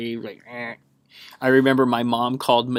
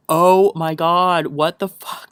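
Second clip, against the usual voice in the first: shocked reaction; 4 semitones higher, 6 dB louder, much slower than usual. Retold or imitated. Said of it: "I was like".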